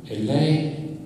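dramatic literary narration, phrase by phrase; chorus commentary. Acted. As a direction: narrate like a tragic chorus, pausing between phrases. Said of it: "io invece sono una voce come del coro che commenta". (voce del coro, narrazione drammatica scandita)

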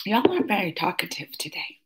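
soft unvoiced teasing whisper. Real, not acted. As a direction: whisper it as a playful nudge to the chat. Teasing chat for being quiet.

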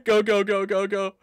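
loud, rapid-fire chant; frantic cheer-on; urgent shouting. Real, not acted. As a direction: yell it over and over to urge them on. Urging on in a game.

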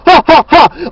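a fake scornful "ha, ha, ha" in imitation; imitated mocking laugh. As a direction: mimic them laughing at you, mockingly. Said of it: "and you laugh".

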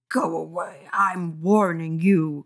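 growling. Acted. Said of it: "Carly Beth growled".

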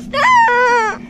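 sobbing yell to stop; crying shout. Real, not acted. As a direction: shout stop through tears.

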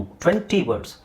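ordinary talk, nothing marked

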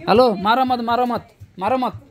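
real urgent plea, don't kill it; urgent calling out. Real, not acted. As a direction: shout across to stop the man hurting it. Stopping someone killing the leech.

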